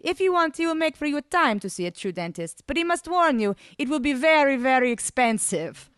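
put-on high, accented, careful English; lilting foreign translator's voice; regretful interpreter. A narrator's reading. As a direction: pass on the message apologetically. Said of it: "informed me regretfully".

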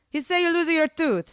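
put-on high, accented broken English; lifted, lilting accented voice; foreign interpreter. A narrator's reading. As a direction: relay it as an anxious Italian translator. Ivan translates in broken English.

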